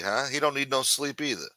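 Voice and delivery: ordinary delivery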